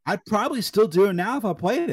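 high, loud burst; worked-up admission. Real, not acted. Admitting the game still scares them, worked-up admission.

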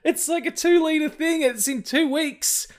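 high, loud disbelieving cry; shocked high squeal. Retold or imitated. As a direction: screech it in outrage. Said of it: "I'm like".

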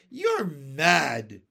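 loud exclamation, punched on MAD; outraged cry. A narrator's reading. Earthworm rejecting James's plan, loud cry. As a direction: cry out in disbelief. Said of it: "cried the earthworm".

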